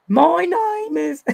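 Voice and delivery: high, drawn-out bad British accent; exaggerated high British attempt; mock British accent. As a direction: stretch it out in a hammed-up British accent. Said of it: "trying to talk in a British".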